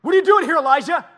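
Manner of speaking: loud, high-pitched angry demand; angry God. Acted. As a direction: bellow it like an outraged God. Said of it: "What do you think his voice sounded like?".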